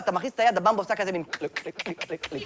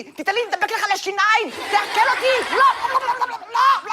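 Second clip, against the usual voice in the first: high-pitched panicked candy cries; screaming gummy bear; 12 semitones higher than usual. Acted. Gummy bear begging not to melt in the sun, screaming. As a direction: plead way up high, hammed-up terror. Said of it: "הוא צועק לך".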